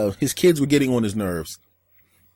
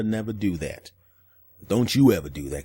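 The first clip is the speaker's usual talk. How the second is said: ordinary talk, nothing marked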